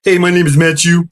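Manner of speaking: imitating a dim roommate; dopey roommate. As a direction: introduce yourself like a simpleton. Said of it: "he was kind of an idiot".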